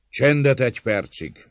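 loud, high commanding call; strong voice. A narrator's reading. Gáspár calling to the defenders, in a strong voice. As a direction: call for silence over the din. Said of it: "mondta erős hangon".

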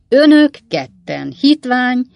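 loud, high, slow denunciation; indignant, drawn-out acted shout; shouted insults. A narrator's reading. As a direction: hurl each word slowly after them. Pickwick shouts insults after lawyers.

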